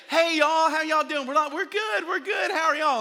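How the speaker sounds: very high, bright "we're good"; overly cheerful greeting. Retold or imitated. Couple answering friends at church, overly cheerful and high. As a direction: gush like nothing happened. Greeting exchange in parking lot.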